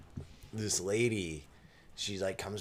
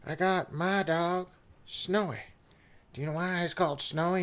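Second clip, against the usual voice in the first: put-on higher, eager old lady showing off her dog; chirpy old lady; 8 semitones higher, 3 dB louder than usual. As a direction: perk up like a sweet old lady.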